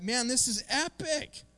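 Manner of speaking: high, slow gushing delight; thrilled. Retold or imitated. Pastor imitating himself thrilled by a friend's verse, high gushing. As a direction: gush over it like a thrilled fan.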